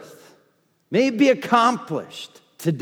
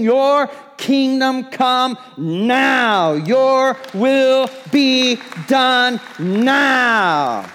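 declaring with faith; 4 semitones higher than usual. Shown as a demonstration. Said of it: "you speak it with faith".

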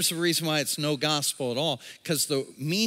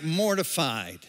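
ordinary talk

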